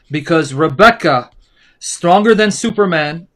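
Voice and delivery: ordinary delivery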